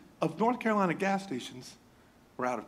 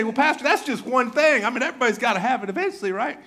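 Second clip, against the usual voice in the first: quick mock excuse, higher and louder; defensive congregant; 7 semitones higher, 4 dB louder, faster than usual. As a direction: rattle off a lame justification. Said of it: "And you say".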